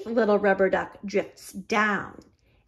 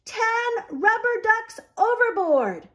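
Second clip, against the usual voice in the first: captain's shout; 12 semitones higher than usual. A narrator's reading. Captain shouting to the crew about lost ducks. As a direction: shout the alarm across the deck. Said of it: "shouts the captain".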